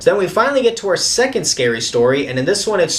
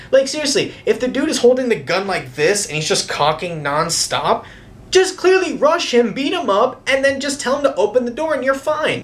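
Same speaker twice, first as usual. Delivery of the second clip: exasperated rant; 7 semitones higher than usual. Real, not acted. Ranting at dumb movie hero.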